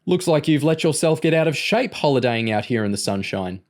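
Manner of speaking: mock him with malicious relish; evil-grinning taunt. A narrator's reading. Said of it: "breaking into an evil grin".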